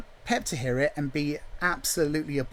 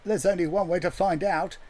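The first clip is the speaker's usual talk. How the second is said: usual speaking voice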